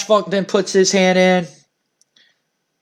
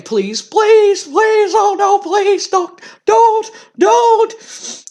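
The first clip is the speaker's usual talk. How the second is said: panicked begging; slower than usual. Retold or imitated. Paul Bearer begs Undertaker not to hurt him, high panicked pleading. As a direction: beg for your life in a high squeal. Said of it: "is begging".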